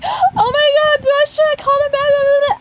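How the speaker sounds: hammed-up alarm, tearful and high; panicking mom. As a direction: imitate a flustered mom fretting. Imitates panicking mom on scam call.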